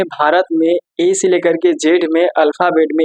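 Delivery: normal talking voice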